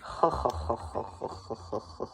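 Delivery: low airy villain cackle; Green Goblin cackle. Real, not acted. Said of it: "Why do I sound like the Green Goblin".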